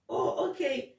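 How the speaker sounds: ordinary delivery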